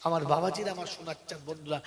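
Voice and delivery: ordinary talk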